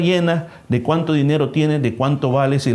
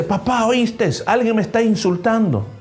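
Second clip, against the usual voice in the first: put-on scared child; 7 semitones higher than usual. Child tells father of insults.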